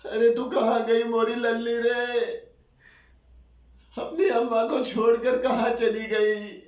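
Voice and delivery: high, loud, drawn-out sing-song lament; keen for a lost daughter, stretching the last words; wailing mother. Acted. Mother mourning her dead little daughter, wailing. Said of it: "जैसे बैन करती हुई मेरी अम्मा".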